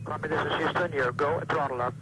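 thin radio transmission voice; mission control radio. Real, not acted. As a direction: call the crew over the radio link. Capcom radio call to crew.

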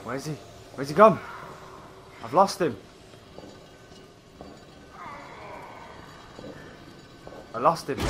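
low and soft, tense; hushed. Real, not acted. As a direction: keep your voice down while hiding. Hiding from a monster.

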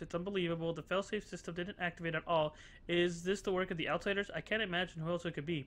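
reads the game's email aloud in a lightly voiced, even tone; reading the note to the viewers, a light dramatic read. Streamer reads a found email to viewers, lightly voiced.

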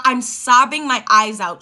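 usual speaking voice

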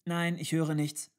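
put-on high, loud child's answer; small child. A narrator's reading. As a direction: answer loudly in a child's pitch. (laute, hohe Kinderstimme)

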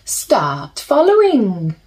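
gentle storybook reading with slow pauses; soothing storybook narration for little listeners; reading to children. A narrator's reading. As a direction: read it like a bedtime picture book. Reads picture book to children.

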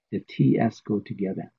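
ordinary delivery

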